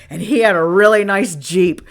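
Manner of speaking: normal talking voice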